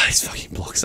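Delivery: whispered frustrated curse. Real, not acted. Streamer annoyed with the blocks, whispered curse. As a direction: curse the blocks under the breath.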